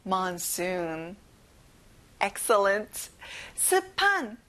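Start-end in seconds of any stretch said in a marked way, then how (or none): 0.0-1.2 "monsoon" with the second syllable's oo held long; lengthened oo at the end of "monsoon"; long final oo. Shown as a demonstration. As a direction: stretch the "soo" in "monsoon". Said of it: "뒤에 우 발음을 길게 하셔야".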